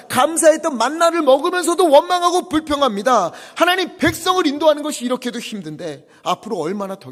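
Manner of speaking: loud, high, pleading prayer; anguished complaint. Retold or imitated. Moses crying out to God about the people, loud anguish. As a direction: pour out his frustration to God. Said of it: "이렇게 고백해요".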